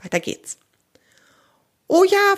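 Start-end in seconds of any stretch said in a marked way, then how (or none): 1.9-2.4 high, louder burst of delight. A narrator's reading. Hungry squirrel accepts beechnuts, delighted. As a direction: jump at the offer gleefully. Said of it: "freut sich Hagen".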